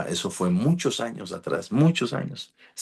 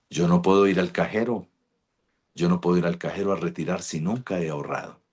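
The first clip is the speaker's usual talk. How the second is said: ordinary talk, nothing marked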